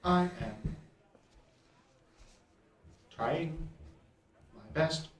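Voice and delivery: robotic voice cracking under strain; breaking robot voice. Acted. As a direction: let the robot voice break apart. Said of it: "it's almost like it's breaking".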